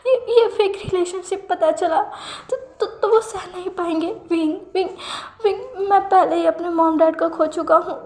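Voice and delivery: high, breaking sobbing plea rising to a wail; a nephew afraid of losing his uncle, sobbing to his partner; crying. A narrator's reading. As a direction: stutter and sob, voice climbing into a final cry. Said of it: "लैंजन रोते हुए कहता है".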